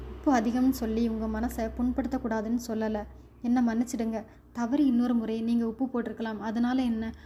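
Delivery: ordinary delivery, nothing marked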